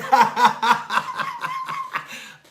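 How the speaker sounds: loud high-pitched cackle; shrieking laughter, out of control. Real, not acted. Laughing at friend's box.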